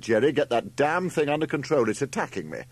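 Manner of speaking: loud, high order, easing off at the end; urgent command from someone under attack. A narrator's reading. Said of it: "Larry commanded".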